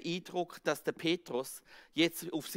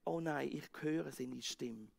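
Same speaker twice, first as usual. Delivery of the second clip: much softer, awed recognition; soft relief; 5 semitones lower, 10 dB quieter than usual. Acted. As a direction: breathe it out in relief. Relief hearing Jesus.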